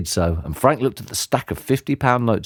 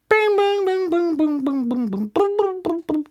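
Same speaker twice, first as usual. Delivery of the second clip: high sung bing bing like church bells; bell chimes. A narrator's reading.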